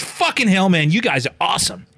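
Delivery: high, loud imitation of gushing admiration; amazed exclamation. Shop fella praising his customers, amazed. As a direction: exclaim it like an impressed fan.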